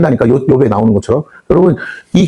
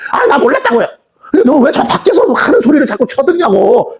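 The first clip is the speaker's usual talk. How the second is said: put-on child's whine; 10 semitones higher than usual. Child excuses, God scolds.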